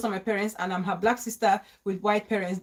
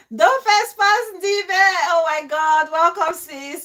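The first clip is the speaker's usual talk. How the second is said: excited greeting; 6 semitones higher, 7 dB louder than usual. Real, not acted. Host greeting a viewer who joined the live, thrilled. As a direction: gush over a viewer joining.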